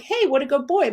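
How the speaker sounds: put-on high, cheerful "what a good boy"; happy praise. Shown as a demonstration. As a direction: sound truly pleased with him. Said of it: "my face is happy".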